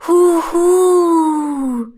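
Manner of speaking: loud, high, long-drawn ghost howl; spooky mock-ghost wail; ghostly hooting. A narrator's reading. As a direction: hoot like a spook, stretching the last vowel. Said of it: "macht es noch ein drittes Mal".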